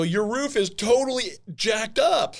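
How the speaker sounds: loud, high, drawn-out retort; emphatic exclamation. Retold or imitated. Roofer telling a homeowner about her roof, loudly exasperated. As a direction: blurt it out slowly, punching each word.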